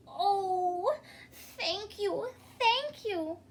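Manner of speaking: a touch of happy sobbing; lightly sobbing thanks. Acted. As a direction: blubber out grateful thanks. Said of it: "he sobbed all the louder".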